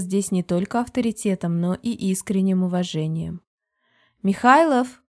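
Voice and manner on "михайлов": louder, higher call by surname; raised summoning call; calling someone over. A narrator's reading. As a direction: call out to a colleague to summon him. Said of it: "позвала она мужчину".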